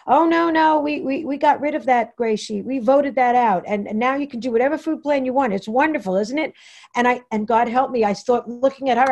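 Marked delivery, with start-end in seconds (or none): 0.1-6.5 high, bright, chirpy reassurance; cheery desk woman. Retold or imitated. Desk woman at the office, chirping happily about the new plan. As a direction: gush like everything is wonderful. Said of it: "And she said to me".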